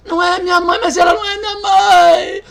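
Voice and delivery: the woman refusing the stand-in mother, shouting; shouted denial. Retold or imitated. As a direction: fling the refusal back. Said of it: "gritar e chorar".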